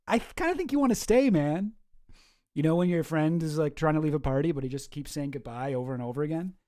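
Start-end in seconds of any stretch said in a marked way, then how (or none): 0.1-1.7 pitched up, teasing; high teasing voice. Retold or imitated. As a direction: tease him, high. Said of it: "Tim's like".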